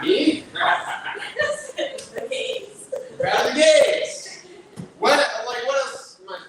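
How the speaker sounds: high, laughing through the words. Real, not acted. Comic laughing at the crowd's reaction, high. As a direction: crack up while still talking.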